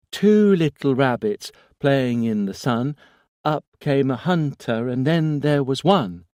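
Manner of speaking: put-on high, loud childlike rhyme; eerie child chanting; little boy voice. A narrator's reading. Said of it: "The voice seemed to grow stronger".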